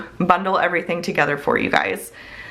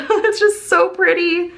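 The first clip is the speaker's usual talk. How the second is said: higher and slow, laughing into a dreamy coo; cooing delight; 11 semitones higher than usual. Real, not acted. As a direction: melt over how pretty it is, drawn out. Gushing over cute planner scenes.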